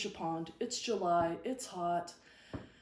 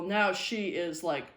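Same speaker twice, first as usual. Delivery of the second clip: normal talking voice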